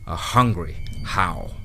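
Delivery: ordinary delivery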